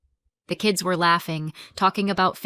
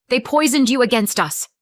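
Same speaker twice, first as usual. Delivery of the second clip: high loud bitter accusation; spitting anger; 5 semitones higher, 7 dB louder than usual. A narrator's reading. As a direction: hurl the blame. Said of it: "she spit".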